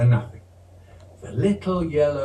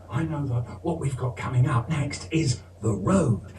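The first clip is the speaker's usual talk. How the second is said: usual speaking voice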